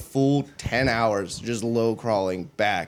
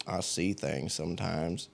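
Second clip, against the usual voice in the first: put-on Appalachian-Southern accent; 7 semitones lower, 10 dB quieter than usual. Kentucky recruit to the fire guard, imitated Appalachian-Southern accent. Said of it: "mix of Appalachian and Southern accent".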